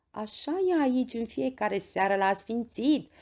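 plain reading voice

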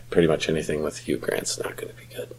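soft unvoiced whisper, confiding; whispered aside. Real, not acted. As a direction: lean in and whisper the jab. Whispered aside about Hugh Grant.

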